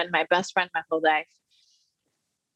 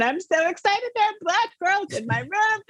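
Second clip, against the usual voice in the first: excited; 11 semitones higher than usual. Retold or imitated. Thrilled teen meeting new roommates.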